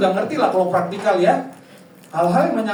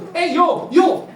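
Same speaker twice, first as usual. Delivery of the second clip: high, loud "yo" to classmates; mock teenage shout of greeting; student-style calling out; 9 semitones higher, 8 dB louder, slower than usual. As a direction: holler like a rowdy pupil. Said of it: "Murid jadi guru, bisa masuk kelas gitu".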